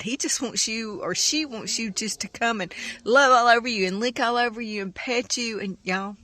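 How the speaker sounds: usual speaking voice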